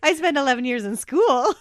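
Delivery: laughing. Real, not acted. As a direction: laugh while you say it. Giggling banter about school years.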